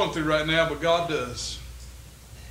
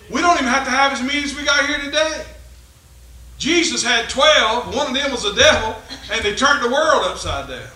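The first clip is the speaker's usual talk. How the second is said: raised, higher preacher's voice; impassioned preaching; 7 semitones higher, 7 dB louder than usual. Real, not acted. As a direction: drive the point home to the congregation. Preaches at full force.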